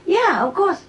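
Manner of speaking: high lightly voiced master; a hint of a breezy master; master's reply. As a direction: chirp a casual agreement. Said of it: "Master said".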